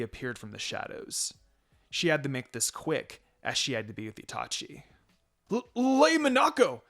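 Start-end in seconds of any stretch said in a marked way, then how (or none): 5.5-6.8 high, loud, stammering cry of fear; shocked stammer on recognizing her; stuttered in fear. A narrator's reading. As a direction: trip over the name in panic. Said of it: "Suzuki stuttered".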